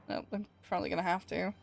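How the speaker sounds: usual speaking voice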